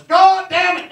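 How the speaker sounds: high, loud furious outburst; a grumpy dad losing it with his son after three toy stores; dad snapping. Retold or imitated. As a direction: explode at the kid in the car. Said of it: "he snapped and he starts pounding".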